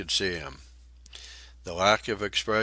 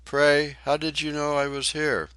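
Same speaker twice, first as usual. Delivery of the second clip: much higher, louder lady's voice, curious; young lady; 8 semitones higher, 6 dB louder than usual. A narrator's reading. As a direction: ask politely in a high ladylike voice. Maud voiced by narrator.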